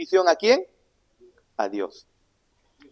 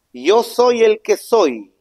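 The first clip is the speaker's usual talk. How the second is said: slow emphatic quote; 6 semitones higher, 5 dB louder, much slower than usual. Shown as a demonstration. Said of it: "Éxodo capítulo tres".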